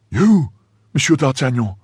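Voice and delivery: high-pitched disbelief; astonished cry. A narrator's reading. Servant recognises the disguised visitor, astonished cry. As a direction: cry out, amazed to recognise him. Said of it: "cried Grimaud".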